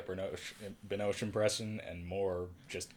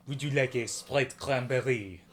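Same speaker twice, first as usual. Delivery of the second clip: put-on slow French-accented offer, a touch louder; French accent impression; 3 semitones higher, 4 dB louder than usual. Host replaying the movie scene, in French accent. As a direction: lean into the French vowels. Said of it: "my Kristen Stewart impression".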